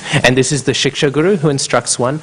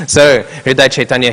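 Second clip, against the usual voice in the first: ordinary delivery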